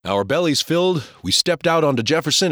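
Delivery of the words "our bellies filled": ordinary delivery, nothing marked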